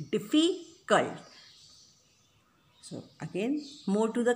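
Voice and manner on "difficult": "difficult" drawn out slowly, dif-fi-cult, higher pitched; syllable by syllable. Shown as a demonstration. Teacher repeating for students to count, "difficult" slowly split into syllables. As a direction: sound out "difficult" slowly with a gap before the last syllable. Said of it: "how many times you stress the word".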